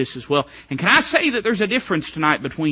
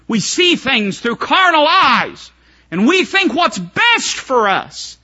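preaching swell; 10 semitones higher, 6 dB louder, much slower than usual. Acted. Preaching climb.

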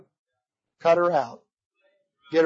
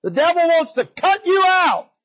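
high, drawn-out preacher's cry; full-force preaching; 9 semitones higher, 3 dB louder than usual. Acted. Preacher warning the flock about the devil, full-force preaching. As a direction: cry out the warning, stretching the words.

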